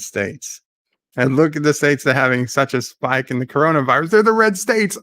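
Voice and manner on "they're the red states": incredulous. Retold or imitated. Mocking Biden's contradiction, incredulous.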